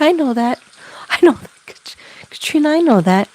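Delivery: put-on high insistent voice of the departed girl; spirit's quote. Medium passing on Katrina's words, high and insistent. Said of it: "She's like".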